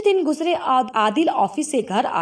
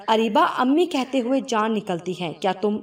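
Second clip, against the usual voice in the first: plain reading voice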